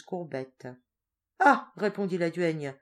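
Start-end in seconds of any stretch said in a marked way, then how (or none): 1.4-1.7 loud high ah; scornful ah. Acted. Old duenna scoffing at the goat, loud ah. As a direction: burst out with a scornful ah.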